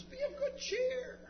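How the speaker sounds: very high, put-on cheery voice; mock the crowd's sudden encouragement, way up high; high-pitched mock cheer; fickle crowd. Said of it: "Now the crowd says".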